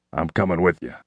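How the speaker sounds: burly shop teacher; gruff man. A narrator's reading. Woodshop teacher Carl speaks.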